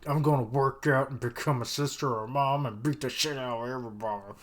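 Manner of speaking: loud, hammed-up vow to beat everyone; Crone's fired-up vow. Trainee swearing revenge on everyone, hammed-up and loud. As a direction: shout the comeback plan like a furious trainee. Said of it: "that's when Crone's like".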